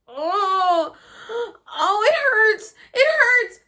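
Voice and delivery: high, loud cries of pain; pained wailing. Retold or imitated. Re-enacting an exorcism-like episode, pained wailing. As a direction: wail in agony, re-enacting it.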